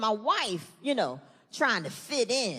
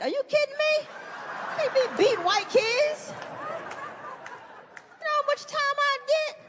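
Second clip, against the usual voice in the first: much higher, slow disbelieving voice; incredulous; 13 semitones higher, slower than usual. Acted. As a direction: stretch out the disbelief. Outraged at the idea.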